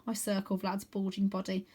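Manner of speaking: ordinary delivery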